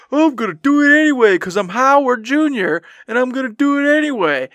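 high, loud bullheaded boasting; mocking past self. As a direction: parrot a cocky, stubborn declaration. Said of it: "And here I go".

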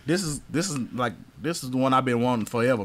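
ordinary delivery

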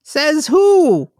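slow, high goofy voice mangling the word; silly high voice. Pretend. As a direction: play the dimwit mishearing, drawn out. Dan's falsetto bit character.

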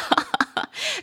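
a short high laugh; high giggle. Real, not acted. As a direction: burst into a giggle. Laughs at own accent.

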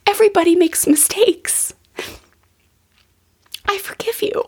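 put-on high, tender young son's voice; little boy. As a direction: lightly imitate a small child forgiving his mother. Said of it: "He looked at me and he goes".

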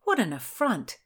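plain reading voice

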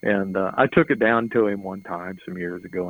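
normal talking voice

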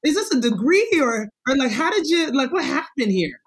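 high and loud, mock disbelief; a friend hearing fancy words, mock incredulous. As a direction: pitch it way up in amazed disbelief. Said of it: "I'm like".

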